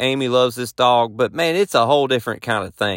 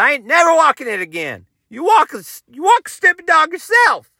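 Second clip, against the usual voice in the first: pretend outburst. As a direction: finally snap at his wife. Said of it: "I said".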